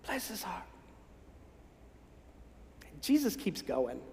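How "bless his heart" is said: breathy whispered put-down; whispered pitying dismissal. Acted. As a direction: whisper it behind a hand. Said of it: "often spoken in a whisper".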